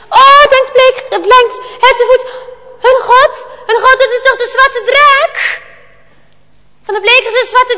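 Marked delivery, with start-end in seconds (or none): 0.1-5.6 higher, louder frightened thought; higher, louder startled child voice; alarmed boy. Acted. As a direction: gasp in fear of the dragon.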